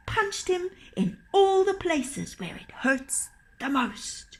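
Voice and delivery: high, loud, building storytelling; excited story narration. A narrator's reading. Narrator tells of the trolls' rampage, rising and excited. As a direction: pile on the chaos as it grows.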